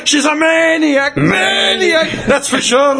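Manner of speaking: high, shouted cartoon maniac song, stretched out; Groundskeeper Willie. Friend quoting a cartoon clip to mates, manic high shout. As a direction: belt it out like a manic Scotsman, half laughing. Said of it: "groundskipper Willie from Sherry Bobbins".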